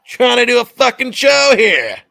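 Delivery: put-on loud, high New York accent; New York accent, annoyed and playful. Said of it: "That was my best New York accent".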